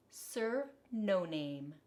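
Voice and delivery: ordinary delivery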